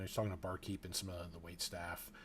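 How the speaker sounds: usual speaking voice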